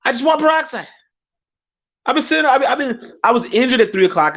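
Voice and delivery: very high-pitched indignant protest; exasperated. Retold or imitated. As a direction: whine in disbelief at being refused. Exasperated reply to scolding friend.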